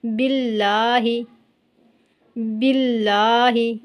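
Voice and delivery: बिल्लाही with a light, thin ल, slow; slow tajweed recitation with thin lam. Shown as a demonstration. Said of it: "पढ़ेंगे normal".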